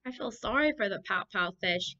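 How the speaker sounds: ordinary delivery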